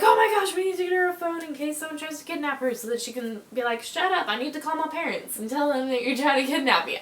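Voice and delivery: lightly voiced fretting parents, starting high and loud; a hint of panicky mom; mock worried parents. Parents fret about kidnappers, lightly mocked worried voice.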